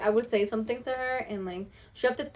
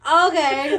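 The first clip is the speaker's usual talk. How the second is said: higher, louder drawn-out okay; loud mock okay; 6 semitones higher, 11 dB louder than usual. Retelling her reaction to the skirt, loud and stunned. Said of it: "And I'm like".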